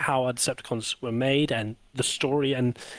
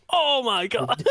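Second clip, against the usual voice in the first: mock excited; 9 semitones higher, much slower than usual. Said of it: "I love that reaction".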